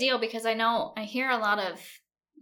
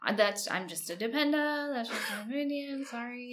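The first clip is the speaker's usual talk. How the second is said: dependent voice; 3 semitones higher than usual. Acted. Host mocking herself as just a dependa giving her opinion, meekly apologetic. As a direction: play up a meek, apologetic military-wife voice. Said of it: "dependent voice".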